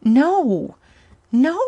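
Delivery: high, loud sobbing denial. Acted. Woman sobbing her answer to her lover. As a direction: cry out the denial. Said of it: "she sobbed".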